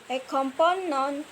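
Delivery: ordinary delivery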